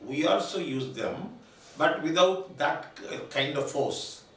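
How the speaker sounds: ordinary delivery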